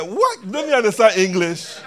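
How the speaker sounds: exasperated. Retold or imitated. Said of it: "so many times that I said".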